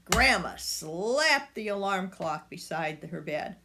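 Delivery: plain reading voice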